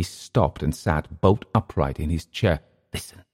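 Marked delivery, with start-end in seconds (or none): 2.9-3.2 hushed, almost whispered; sudden near-whisper; half whisper. A narrator's reading. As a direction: hush them to listen. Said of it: "he half whispered".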